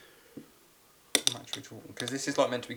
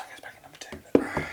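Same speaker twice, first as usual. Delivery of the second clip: whispering; 5 dB louder than usual. Real, not acted. Whispered aside.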